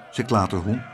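ordinary delivery, nothing marked

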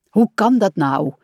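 mildly impatient question. A narrator's reading. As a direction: ask with a touch of impatience. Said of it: "zei Rad ongeduldig".

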